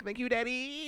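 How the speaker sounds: udawany wysoki dziecięcy głosik; squeaky child voice. Retold or imitated. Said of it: "He'd be like".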